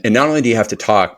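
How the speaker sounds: usual speaking voice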